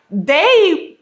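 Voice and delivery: ordinary delivery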